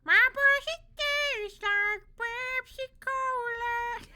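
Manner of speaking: high, loud puppet singing; Elmo. Retold or imitated. Streamer singing the song as Elmo, high and loud. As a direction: squeal it out slowly as a high puppet voice. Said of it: "Should I do it with Elmo?".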